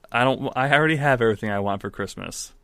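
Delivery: normal talking voice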